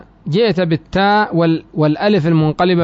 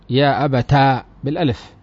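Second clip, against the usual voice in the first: ordinary talk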